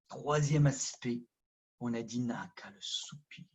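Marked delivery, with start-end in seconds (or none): none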